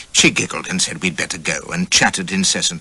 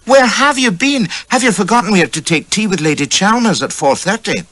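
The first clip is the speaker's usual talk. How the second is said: reproachful wife; 13 semitones higher, 4 dB louder than usual. Acted. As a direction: scold him gently for being late, pitched high. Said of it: "she cries out".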